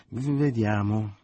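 mock-serious mother drawing it out; playful mock weighing. A narrator's reading. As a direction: pretend to consider. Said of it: "disse con facile parola la madre".

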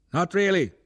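high, loud shout; short high-pitched call; called-back reply. A narrator's reading. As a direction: shout it back over the engines. Officer answers from the tank.

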